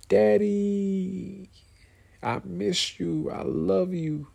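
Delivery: put-on high, sweet child's voice; little kid voice. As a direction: imitate your small kids greeting daddy. Dad voicing his kids' greeting.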